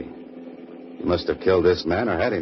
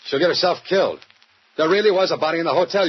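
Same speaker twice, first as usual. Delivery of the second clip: higher and louder, rushing by the end; heated protest; 10 semitones higher, 3 dB louder than usual. Acted. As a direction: insist hard, getting more worked up. Holliday protesting to the police.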